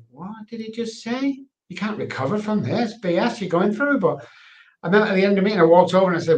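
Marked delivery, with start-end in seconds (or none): 0.1-4.0 incredulous disbelief. Retold or imitated. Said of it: "And I was like".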